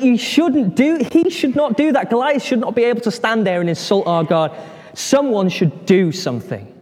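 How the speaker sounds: high, agitated objection easing down; acted indignant protest. David watching his countrymen quake, indignant protest. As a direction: protest in mock outrage, pitch settling as it goes.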